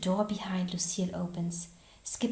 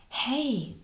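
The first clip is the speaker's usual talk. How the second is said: higher-pitched call for attention; mild shout; 6 semitones higher than usual. A narrator's reading. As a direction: call out, lightly raised. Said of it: "May shouts".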